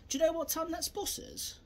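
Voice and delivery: playing dumb. Shown as a demonstration. Asking an aggressor at the bus stop, playing dumb. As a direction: act a harmless idiot asking the time. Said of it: "I'm acting like an idiot".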